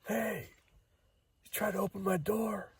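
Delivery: put-on high-pitched yell at the kids; retold yell. Yelling at kids who tried the car door. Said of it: "stood out and yelled".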